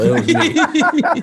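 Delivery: very high, slow, laughing punchline; giggling mock confession; laughing joke. Real, not acted. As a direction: crack yourself up taking the credit.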